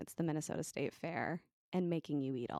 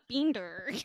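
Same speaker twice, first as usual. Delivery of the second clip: raised, exaggerated American accent; louder, hammed-up American drawn-out R; mock American accent; 6 semitones higher, 5 dB louder than usual. Host imitating how her accent gets mimicked, mock American accent. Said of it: "That's how Sean does my accent".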